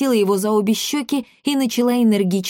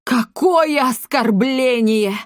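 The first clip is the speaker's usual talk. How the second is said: high, slow wail of offence; theatrical outrage; 5 semitones higher, 3 dB louder, slower than usual. Acted. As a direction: lament like a wronged mother. Mother theatrically takes offence.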